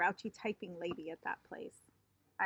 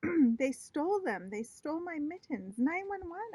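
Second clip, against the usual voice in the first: shouting; 7 semitones higher, 4 dB louder than usual. Acted. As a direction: shout it out for everyone. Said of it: "I shouted some more".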